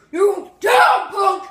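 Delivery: loud high taunting yell; tough guy shout. Acted. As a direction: bark it at the punk.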